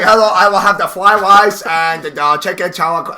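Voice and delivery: hammed-up Chinese restaurant order accent; mock Chinese accent. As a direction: parody Chinese accent swapping R for L. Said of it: "go out to, like, a Chinese restaurant".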